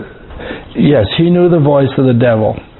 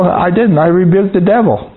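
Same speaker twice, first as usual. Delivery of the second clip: ordinary talk, nothing marked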